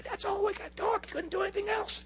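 thin, very high strained voice; torn vocal cords voice. Shown as a demonstration. Pastor showing how he preached with torn cords, high strained voice. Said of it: "I would talk like this. ... Just like that".

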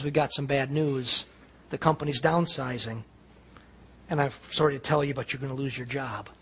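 low, soft, lightly acted supervisor; subdued bad news. Supervisor telling worker of downsizing, mildly subdued. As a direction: break it gently, voice dropped.

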